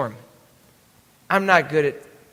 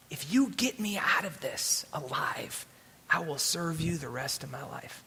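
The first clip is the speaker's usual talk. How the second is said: soft plea fading to a whisper; hushed retold prayer; 3 semitones lower, 9 dB quieter than usual. Sailor pleads with God in the storm, hushed prayer. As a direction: bargain earnestly with God, very quietly.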